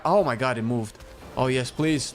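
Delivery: ordinary talk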